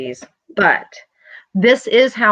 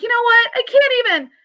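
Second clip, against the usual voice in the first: much higher, hammed-up "I can't even"; fed-up exasperation; faster than usual. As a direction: throw up your hands, done with it all. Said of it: "You're feeling like".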